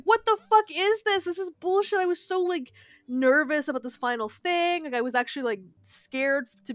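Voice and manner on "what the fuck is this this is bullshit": high, loud indignant outburst; retold outrage. Gamer recalling the boss fight, indignant and loud. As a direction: spit out the disbelief. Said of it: "It really pissed me off".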